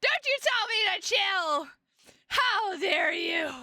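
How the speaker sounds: theatrical offended yelling; mock outrage. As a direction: play it scandalized, drawing out the last words. Mock outrage at being told chill out.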